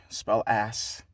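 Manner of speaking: ordinary delivery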